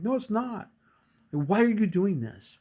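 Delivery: high-pitched retold exasperation; indignant protest. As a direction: act out a frustrated player pleading with his coach. Player protests at laughing coach.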